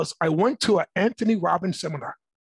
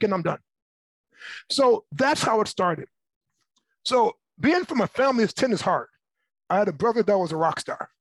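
normal talking voice

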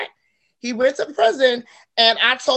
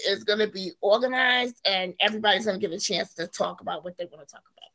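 ordinary talk

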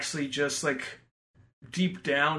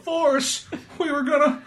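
much higher, pleading voice; whiny Bubba; 11 semitones higher than usual. Retold or imitated. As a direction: plead high like a hurt friend. Voicing Bubba, high.

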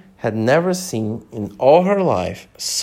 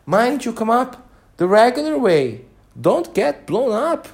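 high, louder warning from the queen; queen's high fretting voice; breathless queen; 11 semitones higher, 4 dB louder than usual. A narrator's reading. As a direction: scold him still catching your breath. Queen still breathless, warning king.

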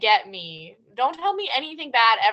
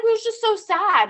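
mom voice, higher; 9 semitones higher, 4 dB louder than usual. Retold or imitated. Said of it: "And she's like".

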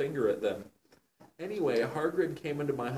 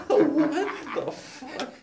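laughing mock outrage; 8 dB louder, much slower than usual. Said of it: "He said back".

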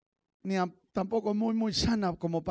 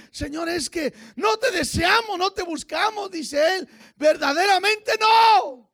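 high, loud revival preacher; impassioned pulpit cry in raised pitch; full-force preaching; 8 semitones higher, 7 dB louder, slower than usual. Acted. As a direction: preach it hard, drawing out key words.

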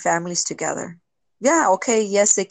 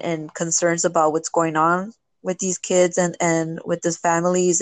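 usual speaking voice

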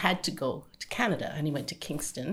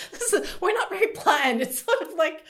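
laughing; 13 semitones higher, 3 dB louder than usual. Real, not acted. Laughing while explaining school choice.

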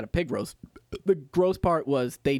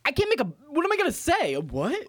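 flustered high voice; 8 semitones higher than usual. Retold or imitated. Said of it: "And I'm like".

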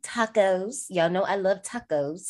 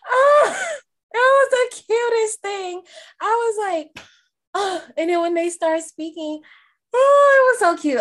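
high, loud, laughing through real tears; choked up and laughing at herself; real tears breaking through the laugh; tearful laughing; 5 dB louder than usual. Real, not acted. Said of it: "Not me crying for real".

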